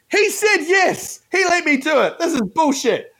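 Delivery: high-pitched, incredulous complaint; whiny outrage, played up for laughs; mock indignant protest. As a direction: protest the injustice in a high, exasperated voice.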